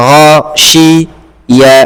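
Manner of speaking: গাসিয়া drawn out very slowly, syllable by syllable; broken into syllables. Shown as a demonstration. Teacher showing the student how to break গাসিয়া, very slow. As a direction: spell গাসিয়া out slowly piece by piece. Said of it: "ভেঙে ভেঙে পড়ো".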